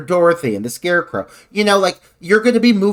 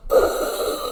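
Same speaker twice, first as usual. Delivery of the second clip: mimicked heavy breathing; 4 dB quieter than usual. Mimicking breathing in a suit of armor, heavy mock breaths. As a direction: breathe audibly as if inside a metal mask.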